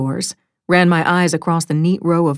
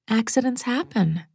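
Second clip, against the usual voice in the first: soft, higher-pitched reassurance; comforting mother; 6 semitones higher, 6 dB quieter than usual. Acted. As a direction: gently let the child off the hook. Mother comforts her child.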